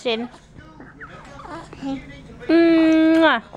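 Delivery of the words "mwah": loud smacking kiss; kiss sound. Pretend. Mother makes a kissing noise for her baby. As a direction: plant a big smooch for the baby.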